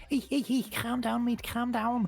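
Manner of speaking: very high, hammed-up Scouse impression. Recalling a comedy sketch about Scousers, exaggerated Scouse. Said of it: "super stereotypical Scousers. They're like".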